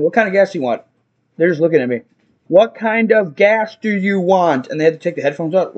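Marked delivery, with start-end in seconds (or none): 2.5-4.7 slow, deliberate question; insistent repeat. Retold or imitated. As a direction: spell it out slowly for someone not hearing. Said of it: "I just keep getting louder".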